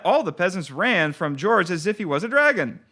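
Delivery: ordinary delivery, nothing marked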